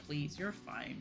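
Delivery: ordinary talk, nothing marked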